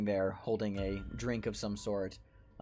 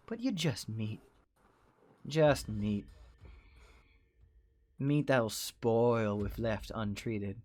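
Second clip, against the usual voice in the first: slow, deliberate threats with long pauses; menacing boss; 3 semitones higher than usual. Acted. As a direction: condescend to your worker, measured and unhurried. Plays menacing boss, slow speech.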